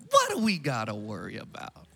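voice going up. Shown as a demonstration. Pastor showing how you say it when you mean it, voice going up. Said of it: "If your voice goes up like that".

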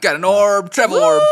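loud, bright and thrilled; excited. Real, not acted. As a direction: cheer it out. Excited at item.

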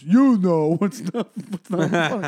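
loud, slow, drawn-out heckler slur; mock drunken heckle; imitated drunk heckler. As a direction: mimic a drunk heckler, breaking into a laugh.

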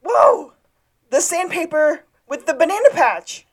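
high, loud yelp imitating a scream; loud, high-pitched imitated outburst; mock scream. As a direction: cry out in hammed-up alarm. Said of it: "Sean screams".

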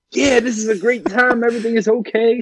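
mock chipper dad. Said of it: "the dad seemed so like".

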